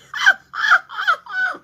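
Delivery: high, squealing burst of laughter; big laugh. Real, not acted. Laughing hard at the viewers' emojis.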